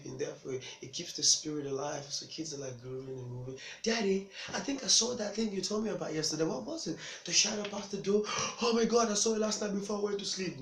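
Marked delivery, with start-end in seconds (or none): 3.8-10.6 put-on higher-pitched kid telling on a scary shadow; small kid's eager, high-pitched report; excited child. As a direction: play a worked-up child reporting to daddy. Excited child tells dad of shadow.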